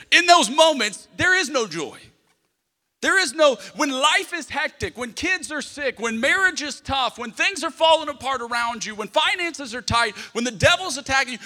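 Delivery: loud, impassioned sermon delivery; fiery preaching. Acted. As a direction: preach it hard to the crowd. Preaching with full force.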